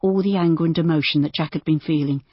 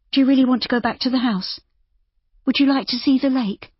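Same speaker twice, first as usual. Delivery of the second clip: put-on higher, faster coaxing young woman; 8 semitones higher than usual. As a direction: coax him gently, a bit quickly. Narrator voices Judy.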